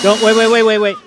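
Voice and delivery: loud, quick "wait, wait" to stop him; urgent calling out. Real, not acted. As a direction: call out to stop someone. Stopping someone blowing smoke.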